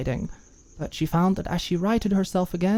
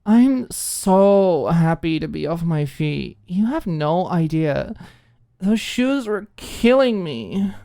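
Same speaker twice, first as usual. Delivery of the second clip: put-on slurred drunk speech; 4 semitones higher, 4 dB louder than usual. A narrator's reading. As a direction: drag the words out, tipsy and bold. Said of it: "continuing boldly on with slurred speech".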